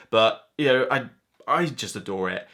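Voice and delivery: normal talking voice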